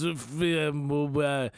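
garbled drunk mumbling imitation; drunk regular. Drunk regular fumbling for words at two strangers, garbled drunk imitation. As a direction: trail through half-formed words like a drunk. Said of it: "I'm doing my best impression of".

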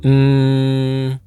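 usual speaking voice

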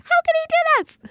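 very high, squeaky mock outrage; shrill-pitched parody of wounded fury; mock outraged old self. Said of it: "the old me would have been so mad".

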